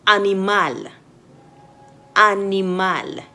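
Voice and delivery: animal with an open French A; clear French A. Shown as a demonstration. Teacher models French A for students. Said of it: "Let's look at some words".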